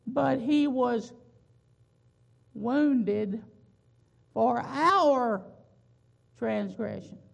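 slow, loud, each word set apart; emphatic preaching. Acted. Preacher hammers home "wounded for our transgressions".

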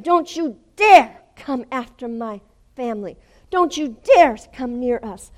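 loud, high, fierce rebuke; shouted warning. Retold or imitated. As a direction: punch out a protective threat. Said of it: "I'm shouting at him".

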